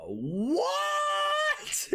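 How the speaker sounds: sudden high squeak of surprise; delighted squeal. Real, not acted. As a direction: squeak it out, overwhelmed.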